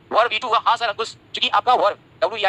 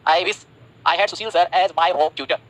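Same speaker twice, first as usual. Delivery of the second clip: slow dictation; 2 semitones lower, much slower than usual. Shown as a demonstration. Teacher dictates example sentence slowly.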